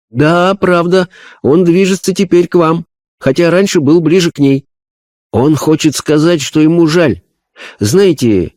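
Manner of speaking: high, earnest medium voice; a spirit medium describing a vision; seance medium. Acted. Medium passes a message. (голос медиума на сеансе)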